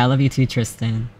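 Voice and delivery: normal talking voice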